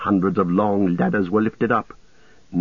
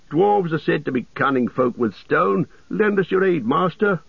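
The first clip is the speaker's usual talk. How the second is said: higher, appealing voice; earnest old soldier; 8 semitones higher than usual. A narrator's reading. Gamling asking the dwarf for aid, earnest appeal. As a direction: lift the pitch into a plea for help.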